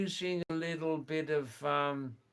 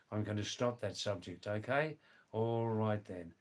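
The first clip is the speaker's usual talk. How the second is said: normal talking voice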